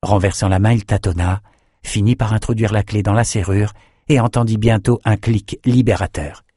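usual speaking voice

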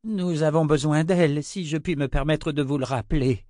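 high, urgent deferential voice; timid interjection. A narrator's reading. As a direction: remind your master, pitched high and pressing. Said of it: "osa souffler Armina".

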